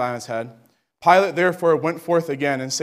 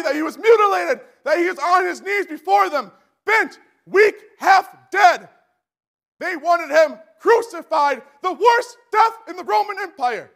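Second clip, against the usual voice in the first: impassioned preaching; 12 semitones higher than usual. Real, not acted. Preacher telling the congregation of Jesus' suffering, fervent. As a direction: preach it at full force, near shouting.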